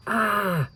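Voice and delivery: sharp high yelp of surprise; a sudden high "Ah!"; startled shout. A narrator's reading. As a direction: cry out as you're jerked into the air. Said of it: "Jack shouted".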